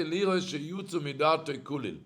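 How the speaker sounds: ordinary talk, nothing marked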